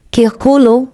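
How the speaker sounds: usual speaking voice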